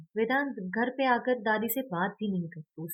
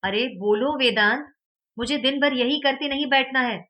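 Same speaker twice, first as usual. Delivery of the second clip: loud, higher angry shout; 5 semitones higher, 10 dB louder than usual. A narrator's reading. Teacher shouting at Vedant to speak up. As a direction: yell at the pupil to answer. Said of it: "उस पे चिल्लाते हुए कहते हैं".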